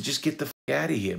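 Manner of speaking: fast, high-pitched exasperation; rushed, fed-up brush-off; retold angry dismissal. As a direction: act out shooing someone away, quick and high. Retelling his angry dismissal.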